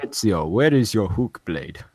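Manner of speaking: loud, slow hammed-up Italian accent; mock Italian accent. Streamer retelling a game scene to friends, thick mock Italian accent. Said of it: "in his thick Italian accent".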